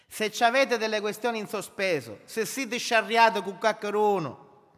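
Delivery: loud, high, fervent sermon voice; preaching at full force. Acted. Pastor exhorting his congregation to make peace, loud and fervent. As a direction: build it up and hammer the church.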